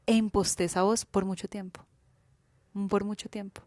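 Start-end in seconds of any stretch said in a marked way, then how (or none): none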